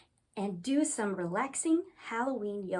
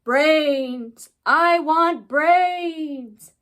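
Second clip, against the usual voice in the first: loud, slow, drawn-out zombie groan; zombie moan; 5 semitones higher, 13 dB louder, much slower than usual. Acted. Zombie staggering up to the ghoul, loud slow moan. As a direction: moan hungrily, stretching every word. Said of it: "zombie staggered up to me and moaned".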